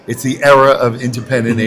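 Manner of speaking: ordinary talk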